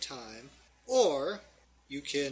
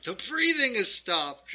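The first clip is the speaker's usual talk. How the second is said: higher, louder halfling voice; excited halfling; 7 semitones higher, 10 dB louder than usual. Acted. Halfling noticing the monster has gone silent, excited. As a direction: announce it with raised voice. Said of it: "And the halfling's like".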